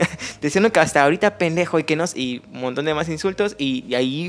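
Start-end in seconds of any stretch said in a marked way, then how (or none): 0.1-1.8 higher, louder, fast furious insult; retold angry yell. Attending surgeon berating the resident in front of everyone, angry yell. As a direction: snap the insult out like a bullying surgeon. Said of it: "frente a todos les estaba gritando".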